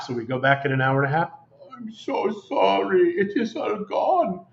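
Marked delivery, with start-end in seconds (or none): 1.8-4.5 slow, higher-pitched mock German accent. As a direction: imitate a German-accented vendor apologising slowly. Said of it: "He spoke with a German accent".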